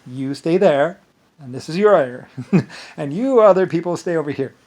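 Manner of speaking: loud commanding directions, half laughing; bossy loud order. Retold or imitated. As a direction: bark out where everyone stays. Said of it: "and I was like".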